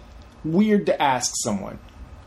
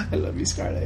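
laughing; 5 dB quieter than usual. Real, not acted. Laughing after mocking the accent.

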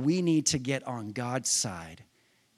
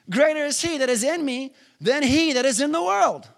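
higher, louder, fast fervent preaching; preaching at full force; 10 semitones higher, 5 dB louder than usual. Real, not acted. Preacher urging people to repeat the verse, fervent shout. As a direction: push it hard and fast.

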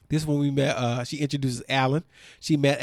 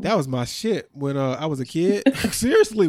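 usual speaking voice

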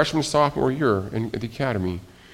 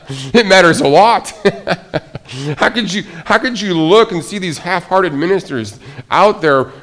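louder, higher, laughing through the words; laughing disbelief; 6 semitones higher, 7 dB louder than usual. Real, not acted. Preacher marvels at Paul to congregation, laughing loud. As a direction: laugh it out in amazement.

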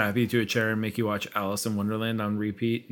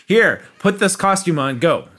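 louder, higher mock order to just get going; brusque boss; 6 semitones higher, 6 dB louder than usual. Host mocking Disney bosses handing over a costume, brusque. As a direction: bark it like a careless manager, half joking. Imitating bosses rushing new hires.

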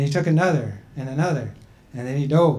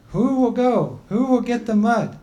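a higher, slightly raised voice for the people; people's voice; 8 semitones higher than usual. Acted. The people telling Grandma Turtle she's too old, in a raised voice.

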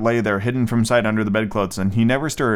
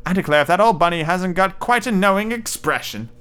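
slightly hammed-up, higher-pitched exclamation; lightly voiced nursery maid; 7 semitones higher than usual. A narrator's reading. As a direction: lift the pitch for a light character quote. Nursery maid's exclamation in the story.

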